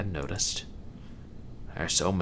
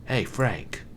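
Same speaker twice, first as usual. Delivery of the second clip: agitated; 5 semitones higher, 3 dB louder than usual. Acted. Agitated appeal in argument.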